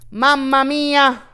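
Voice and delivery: slow, loud mock Italian cry; hammed-up Italian exclamation. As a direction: throw it out like a cartoon Italian. Exclaiming at the mess.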